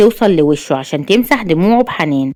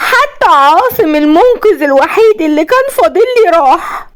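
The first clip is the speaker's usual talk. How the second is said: high unhinged villainess voice; madly hysterical; 12 semitones higher, 4 dB louder than usual. A narrator's reading. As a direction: cry it out high, on the edge of madness. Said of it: "وقعدت تضحك بجنون، وكملت وقالت".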